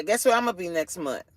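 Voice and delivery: usual speaking voice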